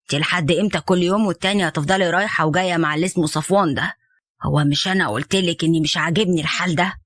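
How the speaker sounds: low, firm father's voice laying down the law; stern father. Acted. Father warning his daughter off Safwan, low and stern. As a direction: play the disapproving dad, deep and unbending.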